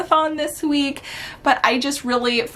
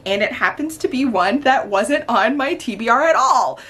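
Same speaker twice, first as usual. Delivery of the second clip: ordinary delivery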